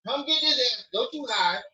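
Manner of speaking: high-pitched playful scolding; mock parental threat. Playfully threatening his kid.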